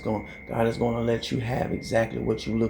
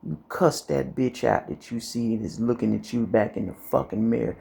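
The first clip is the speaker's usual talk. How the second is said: normal talking voice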